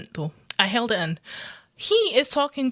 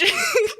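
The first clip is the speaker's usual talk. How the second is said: high laugh. Real, not acted. Laughing at own Scottish rage, high laugh. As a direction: burst of high-pitched laughter.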